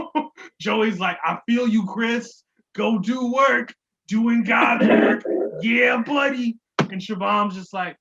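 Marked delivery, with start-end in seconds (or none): 1.2-6.6 higher, louder mock encouragement; hyped-up cheering. Co-host playing Joey cheering on Chris, hyped-up mock support. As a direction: imitate a buddy hyping up his pal.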